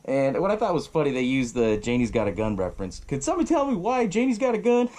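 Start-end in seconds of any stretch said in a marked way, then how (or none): 3.0-4.9 playful high quip. Retold or imitated. Quoting song reference jokingly.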